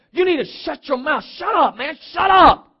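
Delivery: high, forceful telling-off building to a shout; stern scolding. Acted. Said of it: "And then they sternly".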